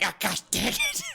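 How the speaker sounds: high, squeezed mock anguish; mock emotional outburst. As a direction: exclaim as if the feelings got to you. Acts out moved audience's reaction.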